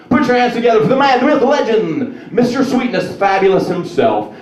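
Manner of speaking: loud rhyming hype-man patter; announcer hype. Pretend. Comedian's dream introduction continuing, loud hype rhymes. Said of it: "I want something to be like".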